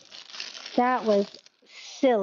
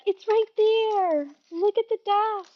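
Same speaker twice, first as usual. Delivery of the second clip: mock chat yelling; 8 semitones higher, slower than usual. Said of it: "You guys are all yelling probably".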